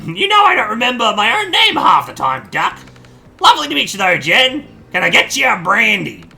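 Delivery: put-on flustered old granny caught with a messy house; old lady voice. Ma greeting her son's surprise guest in a flustered old lady voice.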